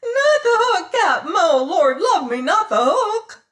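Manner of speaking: very high, loud, crying plea; pitiful squeal. Acted. Pirate begging the captain not to use the hook, a pitiful squeal. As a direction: shriek and beg in terror. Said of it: "his high-pitched voice rising to a pitiful squeal".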